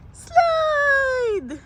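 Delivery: high shouted cheer; excited cheer. Real, not acted. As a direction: cheer her down the slide. Cheering kid down slide. (masayang sigaw)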